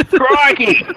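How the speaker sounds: hammed-up Crocodile Hunter cry; mock Aussie exclamation. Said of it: "crocodile Hunter".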